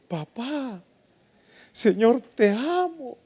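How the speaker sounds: high, tender call to a father; loving cry to God. Acted. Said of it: "Porque ahora le dices".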